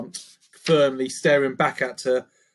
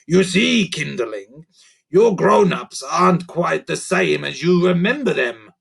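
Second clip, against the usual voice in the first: a touch more serious; 4 semitones higher, 5 dB louder than usual. Acted. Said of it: "a little more serious".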